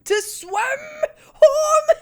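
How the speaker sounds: high, loud disbelief on home; squeaky outraged question; incredulous. Real, not acted. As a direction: repeat it like you can't believe it. Repeats plot point in disbelief.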